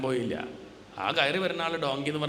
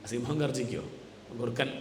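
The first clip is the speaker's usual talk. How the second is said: ordinary talk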